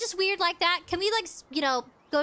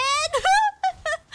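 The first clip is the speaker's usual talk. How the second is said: high, loud squeal of laughter; whooping, high laugh; real laugh; 12 semitones higher, 6 dB louder than usual. Real, not acted. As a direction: burst out in a high-pitched cackle. Laughs at own outburst.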